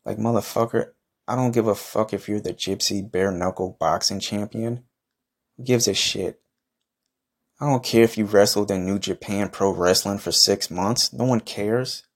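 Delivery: ordinary talk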